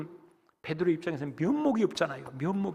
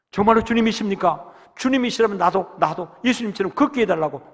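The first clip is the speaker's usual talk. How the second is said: excited plea; 4 semitones higher, 7 dB louder than usual. Acted. Peter calls to Jesus.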